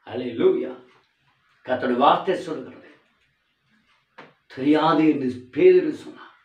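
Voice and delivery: ordinary delivery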